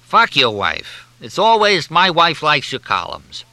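irritated talk through a chewed cigar; Oscar Perlman. A narrator's reading. Columnist rebuffs the captain's compliment, irritably. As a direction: grumble loudly round a cigar. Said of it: "talking through a well-chewed cigar".

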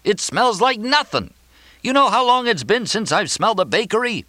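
very high, outraged complaint; yelling. A narrator's reading. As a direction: rail at him at the top of your range. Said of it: "Mortimer yelled".

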